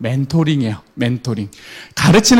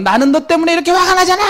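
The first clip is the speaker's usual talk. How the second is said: much higher, fast exasperated yell; angry yell; 10 semitones higher, faster than usual. Retold or imitated. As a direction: shout the I-message in frustration. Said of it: "라고 소리를 치더라도".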